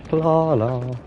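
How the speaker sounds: Hlala sounded slowly, breathy hl at the start; testing the click-like Hlala; careful name attempt. Shown as a demonstration. Streamer trying an African name, careful sounding out. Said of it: "that's my best pronunciation".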